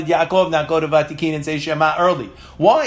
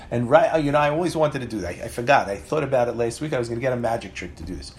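usual speaking voice

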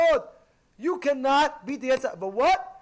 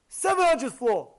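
usual speaking voice

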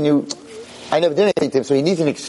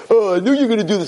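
mock annoyed; 8 semitones higher, faster than usual. [name] angry at the rabbi's jokes, higher exasperated whine. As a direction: huff it out, fed up.

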